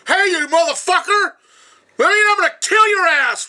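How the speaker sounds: loud, high-pitched raging threat; imitated furious yelling. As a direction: yell like a brother ready to kill. Brother Jacob enraged outside house.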